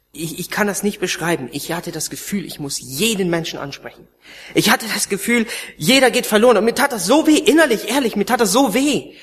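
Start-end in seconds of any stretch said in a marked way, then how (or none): 4.5-9.2 impassioned preaching. Real, not acted.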